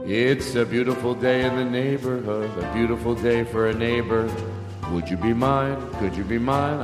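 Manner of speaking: usual speaking voice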